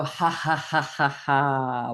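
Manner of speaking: measured ha-has on one out-breath; demonstrated exercise laughter; deliberate laughing sound. Shown as a demonstration. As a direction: practice laugh, each "ha" separate, the last drawn out. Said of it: "a little bit more laughing sound".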